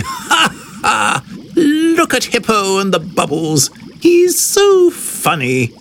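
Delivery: high, acted ha-ha and gleeful call; happy mocking glee. A narrator's reading. Animals laugh at hippo in bubbles, high gleeful voice. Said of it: "are very happy".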